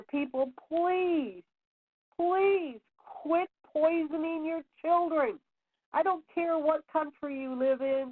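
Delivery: high, slow, aching plea; pleading appeal. Acted. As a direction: beg the parents, voice lifted and stretched out. Her own pleading post to parents.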